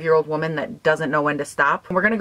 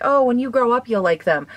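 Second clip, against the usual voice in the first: people quote; 6 semitones higher than usual. Retold or imitated. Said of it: "They're like".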